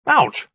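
high, loud "ouch"; yelp of pain. A narrator's reading. Djinni jabbed by magician's spear, yelping in pain. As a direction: cry out at a sudden jab.

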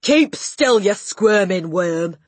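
loud, scornful order; snorting Trunchbull. A narrator's reading. Headmistress to the wriggling boy she holds, angry shout. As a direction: shout the command, drawing out the insult. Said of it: "snorted the Trunchbull".

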